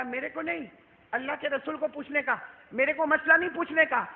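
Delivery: protesting refusal. Retold or imitated. Acts refusing the woman's question.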